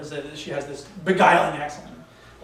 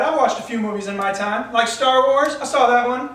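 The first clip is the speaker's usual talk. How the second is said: pompous; 6 semitones higher, 6 dB louder than usual. Acted. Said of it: "I leaned back with a pompous pose".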